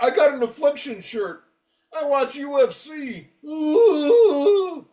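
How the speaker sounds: high-pitched mock bragging, ending in a whooping "ooh"; mocking fanboy. Mocking tough-guy band fans.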